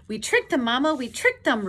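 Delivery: high, loud gleeful squeal; Roo's happy squeak. A narrator's reading. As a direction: squeak it proudly to Mama. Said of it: "Roo squeaked happily".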